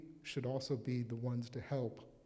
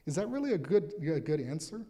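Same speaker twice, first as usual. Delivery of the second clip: incredulous; 2 semitones higher, 8 dB louder than usual. Real, not acted. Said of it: "You just went from saying".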